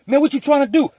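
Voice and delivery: high, loud confrontation; angry challenge. Retold or imitated. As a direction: step up and call him out. Cellmate squaring up.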